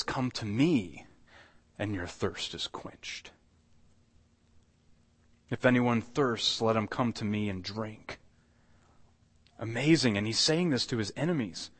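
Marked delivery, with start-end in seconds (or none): 0.0-3.3 soft, slow, gentle call; tender invitation. Acted. Tender invitation voiced for Jesus. 5.5-8.2 gentle invitation. Acted. Invitation repeated softly.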